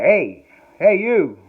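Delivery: loud, insistent "hey, hey, you"; stranger calling out. Retold or imitated. Stranger at the airport calls after them, loud. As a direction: hail them from down the hall. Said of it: "this dude going".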